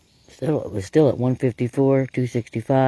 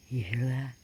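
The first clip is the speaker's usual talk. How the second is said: ordinary delivery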